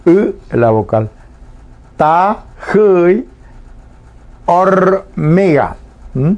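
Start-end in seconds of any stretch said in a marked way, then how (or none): 2.0-3.3 "Ta-ji" slow, with the Guaraní vowel after the j drawn out and stressed; acento en la U. Shown as a demonstration. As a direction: stretch the second syllable of "Ta-ji", higher. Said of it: "Acento en la U". ("Ta-ji" alargando y acentuando la vocal final)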